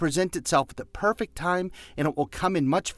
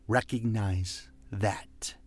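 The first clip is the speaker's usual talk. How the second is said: whisper; 6 semitones lower, 6 dB quieter, slower than usual. Acted. Intimate aside under music.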